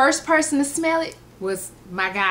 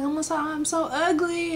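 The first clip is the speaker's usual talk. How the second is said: higher-pitched, self-pitying whine; mock crying whine; 7 semitones higher than usual. Retelling tearful phone call to mom, mock whine. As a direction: wail it lightly, without real tears. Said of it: "I called my mom crying".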